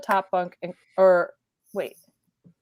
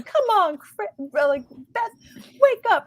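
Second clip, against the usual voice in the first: put-on much higher, sing-song kid brother teasing, slow at first; mock cheery wake-up call; 12 semitones higher, 3 dB louder than usual. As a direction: chirp it out like an overexcited little brother. Brother calling from the hallway.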